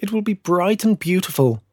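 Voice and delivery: put-on high, delighted child voice; happy children. A narrator's reading. Children looking forward to candlelight, high and merry. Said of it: "the children laughed".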